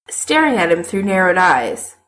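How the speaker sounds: usual speaking voice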